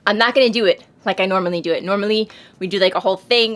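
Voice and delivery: usual speaking voice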